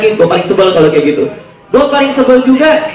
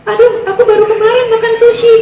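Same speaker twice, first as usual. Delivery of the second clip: high mock-girlfriend voice, much higher than his own; girly falsetto; 13 semitones higher than usual. Hungry girlfriend answering her boyfriend, in high mock falsetto. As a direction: squeak it like a whiny girlfriend.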